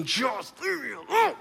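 high, strangled mock scream; exasperated outburst. Fed-up person thinking at a nagging spouse, exasperated. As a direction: burst out in hammed-up frustration. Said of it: "and we're thinking".

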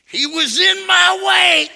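high, loud, drawn-out wail; protesting toddler. Retold or imitated. Preacher plays grandson refusing apology, high toddler wail. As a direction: wail it like an aggrieved three-year-old.

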